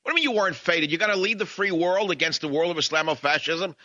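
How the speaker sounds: high-pitched exasperated pep talk; exasperated scolding. Real, not acted. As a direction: straighten him up like a fed-up coach. Scolding McCain, mock coach.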